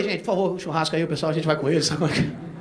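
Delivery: bem mais baixo, em tom de segredo; discreto. Retold or imitated. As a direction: avisa a plateia sem chamar atenção. Fala baixinho à plateia. (fala baixinha e cúmplice)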